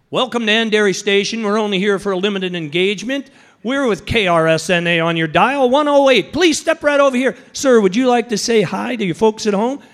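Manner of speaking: loud, punchy station announcer patter; radio announcer. Acted. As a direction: work the crowd like a live radio host. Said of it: "sound like I was a radio announcer".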